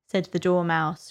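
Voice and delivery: ordinary delivery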